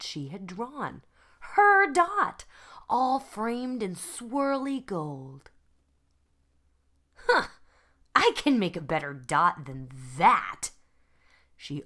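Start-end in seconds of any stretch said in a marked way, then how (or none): 1.5-5.5 amazed. Acted. Surprised to see dot framed. 7.1-10.7 scoffing resolve. Acted. Vashti scoffs, determined.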